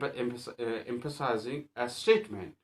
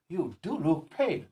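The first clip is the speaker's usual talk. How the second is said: "do" lifted high in "You do look pale"; stress on DO; 5 semitones higher than usual. Shown as a demonstration. Teacher laying emphasis on a statement, stressed "do". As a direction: insist by putting the weight on "do". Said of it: "to lay emphasis on a statement".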